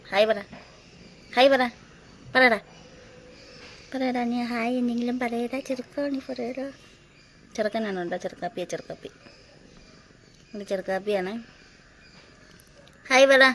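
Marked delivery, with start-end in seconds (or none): 0.1-6.7 higher, playful coaxing voice for the cat; cooing at a pet, gently urging; coaxing the kitten. Real, not acted. 13.1-13.4 loud, high call to the kitten; raised, higher-pitched call to a pet; calling the cat. Real, not acted. Calling to the cat after a pause.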